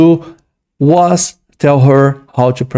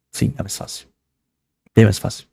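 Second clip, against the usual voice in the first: whispered aside; 4 semitones lower, 7 dB quieter than usual. Real, not acted. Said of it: "concedes under his breath".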